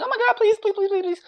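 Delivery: mocking the girls who beg not to be hit, high and whiny; mock girly plea. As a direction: mince it like a helpless girl begging. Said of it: "they put their hands up".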